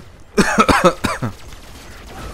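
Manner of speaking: harsh repeated coughs; coughing fit. Real, not acted. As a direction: cough hard to clear the throat. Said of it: "j'ai un truc dans la gorge".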